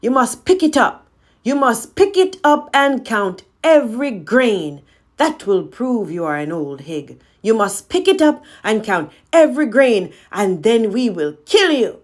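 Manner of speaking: loud, high threatening command; menacing demand. Acted. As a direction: order her with open hatred. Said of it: "said Miriam".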